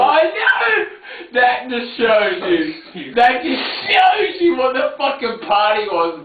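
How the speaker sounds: high shouted disbelief; excited. Real, not acted. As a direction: shout it out in amazement. Excited shout about the party.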